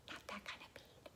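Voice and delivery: very soft, fully whispered correction; whispered aside. Real, not acted. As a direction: whisper it as a quick aside to the pupil. Joking aside on the word.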